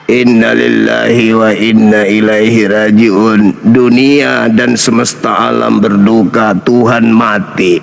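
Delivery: mock solemn mourning. Mocking Jesus's death as if announcing a funeral, mock-solemn. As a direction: intone it like a sarcastic funeral notice.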